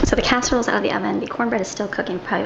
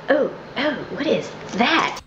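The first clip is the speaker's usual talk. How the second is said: surprised Southerners; 3 semitones higher, slower than usual. Retold or imitated. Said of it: "they're like".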